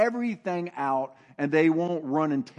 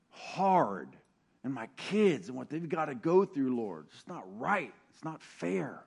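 lament prayer; 2 semitones lower than usual. Shown as a demonstration. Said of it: "see, I do that".

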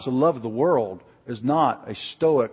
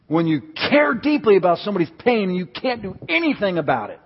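high, loud, building pulpit fervor; impassioned preaching; 8 semitones higher, 8 dB louder than usual. Real, not acted. Preacher rousing his church for God's power, building impassioned preaching. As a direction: drive it up to a ringing rallying cry.

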